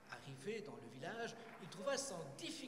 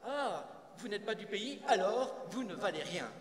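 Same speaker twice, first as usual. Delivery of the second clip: loud, higher, curt verdict; dismissive interruption; 4 semitones higher, 9 dB louder than usual. Acted. Nathan stopping a foreign sage, dismissive. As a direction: cut in and write them off loudly. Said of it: "Nathan l'arrête".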